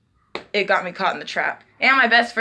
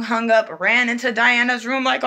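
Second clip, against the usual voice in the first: ordinary talk, nothing marked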